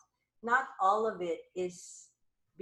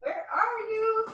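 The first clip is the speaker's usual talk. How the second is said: searching call. Real, not acted. Calling out while searching for something.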